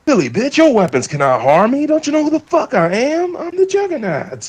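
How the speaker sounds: hammed-up cartoon brute shouting his name; Juggernaut voice. Retelling the Juggernaut's boast.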